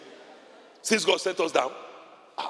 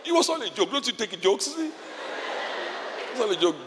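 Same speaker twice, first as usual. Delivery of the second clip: normal talking voice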